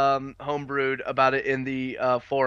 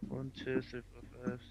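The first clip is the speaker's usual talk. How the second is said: counting under breath; 17 dB quieter than usual. Real, not acted. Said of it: "he is counting right now".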